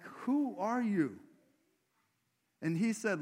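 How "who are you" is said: indignant leaders. Retold or imitated. Leaders challenge Jesus.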